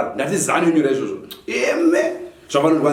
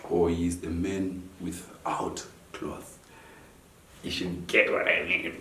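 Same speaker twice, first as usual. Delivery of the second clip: quiet aside; 8 semitones lower, 8 dB quieter, slower than usual. Real, not acted. Low confiding aside.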